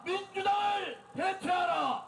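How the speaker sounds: shouted protest speech to a crowd; rally the crowd at full volume; roaring rallying speech. Real, not acted. Leads political rally.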